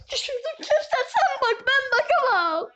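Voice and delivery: high, loud frightened squeal; panicked high squeal. Real, not acted. Panics after dropping something.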